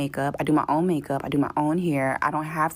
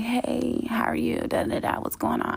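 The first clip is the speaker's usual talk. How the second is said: high, sugary phone greeting that fades to a whisper; sweet assistant voice; 7 semitones higher, 3 dB quieter than usual. Retold or imitated. Mimicking the assistant's high, sugary phone greeting. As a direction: mimic the assistant's too-friendly hello. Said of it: "She's like".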